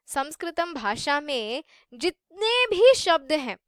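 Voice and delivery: ordinary talk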